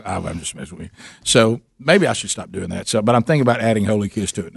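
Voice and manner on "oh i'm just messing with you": soft reassurance. Retold or imitated. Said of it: "And then I go".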